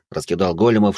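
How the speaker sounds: plain reading voice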